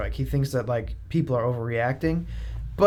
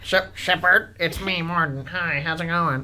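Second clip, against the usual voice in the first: Morden voice; 3 semitones higher than usual. Acted. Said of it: "I'll be Morden".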